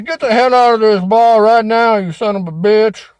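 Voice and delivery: bellowed, furious bartender throwing someone out; angry shout. Acted. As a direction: yell it loud, full of rage.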